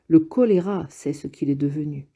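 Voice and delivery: ordinary delivery, nothing marked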